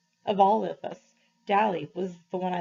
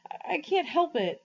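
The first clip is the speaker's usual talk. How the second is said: higher, tearful protest; boy insisting through tears, higher; crying; 8 semitones higher than usual. A narrator's reading. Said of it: "I'll stop crying in a minute".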